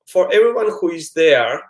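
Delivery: ordinary delivery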